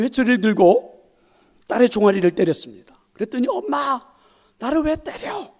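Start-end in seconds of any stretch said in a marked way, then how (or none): 3.5-5.5 put-on high, plaintive little girl; crying child. Preacher voicing a crying daughter asking her mother why.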